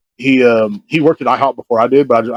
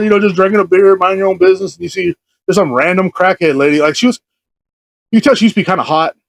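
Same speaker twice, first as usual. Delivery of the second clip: ordinary talk, nothing marked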